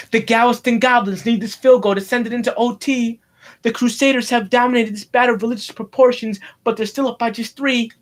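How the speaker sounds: sportscaster calling a tense game; football play-by-play. Pretend. Boy calling his toy football game, tense play-by-play. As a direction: announce the close score like live TV.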